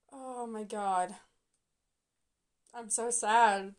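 slow, deflated sigh of words; dejected and dragging, starting soft; so sad. Real, not acted. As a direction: sink into slow disappointment.

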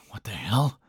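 whispered mutter under the breath. A narrator's reading. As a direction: mutter it almost without voice. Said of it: "Lucas muttered out".

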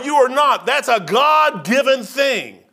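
normal talking voice

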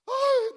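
high, loud mock wail; Orpah's mock wail. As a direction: wail "ay" theatrically. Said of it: "decía Orfa". (lamento agudo y fingido)

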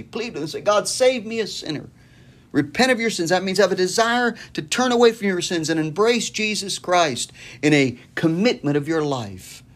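high, pressing plea to repent; urgent appeal. Acted. Preacher urging a sinner to repent, high and pressing. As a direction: lift the voice and plead.

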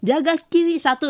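imitated on-court instruction. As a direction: call out a position like a teammate. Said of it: "suara lu atau Gayung bilang kayak".

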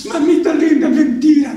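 put-on high, wailing little boy's plea; crying child. A son crying to his mother that he did nothing. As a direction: whimper it like a child caught out. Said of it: "Y el hijo llorando".

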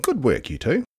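grandparents praising. A narrator's reading. Grandparents praising the children, lightly voiced. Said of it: "they said".

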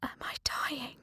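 faint, breathless whisper; a frightened child whispering in the dark. A narrator's reading. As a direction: barely breathe the question. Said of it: "I heard a whisper".

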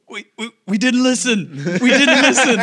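high, loud cartoonish cry of alarm; mock-frantic shouting on the edge of laughter; panicked yelling. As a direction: yell it in exaggerated hysteria, high and loud. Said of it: "you just hear Stanley the whole time being like".

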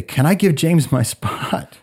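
high, drawn-out retold plea; urgent plea. Allie asking Tom Bergeron to swap places, urgent plea.

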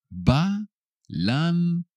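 syllable by syllable. Shown as a demonstration. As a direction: sound out Balance with long gaps for learners. Teacher repeats word by syllables.

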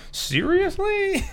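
very high, slow, drawn-out disbelief; incredulous. Real, not acted. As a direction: stretch it out in disbelief. Incredulous reaction.

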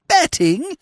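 high, squeaky shocked repeat; aghast at the news; shocked disbelief. A narrator's reading. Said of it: "he gargled".

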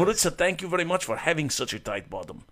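hammed-up Russian guy accent, held for the bit. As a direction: keep the joking Russian voice going. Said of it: "Very good accent".

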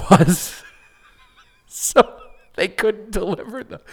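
laughing through the words. Real, not acted. Laughing at the absurd story.

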